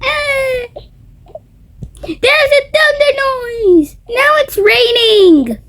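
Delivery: high, slow scared shout; frightened cry. Acted. Child hears thunder and cries out high in fright.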